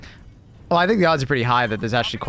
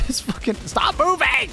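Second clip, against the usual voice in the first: high, frustrated outburst; real irritation boiling over; exasperated yell; 11 semitones higher than usual. Real, not acted. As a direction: snap at the player who won't hold still. Annoyed at teammate in game.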